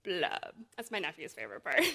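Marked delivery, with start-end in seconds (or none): 0.0-0.6 low glum blub; gurgling fish man croak. Acted. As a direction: sink each blub lower and sadder. Fish bubbles in the story.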